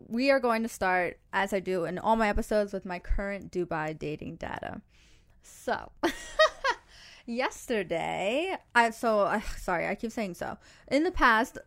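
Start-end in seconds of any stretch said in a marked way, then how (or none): 6.0-6.8 quick high rising giggle. Real, not acted. Host laughs mid sentence.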